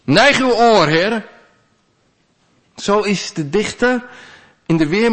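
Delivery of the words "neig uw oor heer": louder and pitched higher, insisting God listen; crying out to God; louder calling. Acted. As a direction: call harder so He must hear. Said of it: "ik ga nog harder roepen".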